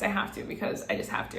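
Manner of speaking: a secretive, hushed aside; whispered under the breath. Real, not acted. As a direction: whisper it to yourself. Aside to herself about red look.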